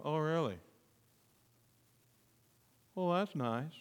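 softly underwhelmed, long pauses between reactions; mock indifference. Preacher acts out how Elizabeth didn't react to Mary, indifferent. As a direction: toss it off softly, utterly unimpressed. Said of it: "she didn't look at Mary and go".

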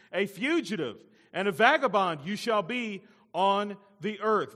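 high, loud pronouncement; passion and authority. Acted. God sentences Cain to wander, passion and authority. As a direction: keep the sentence ringing out with authority. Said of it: "with passion and authority".

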